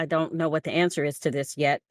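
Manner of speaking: ordinary delivery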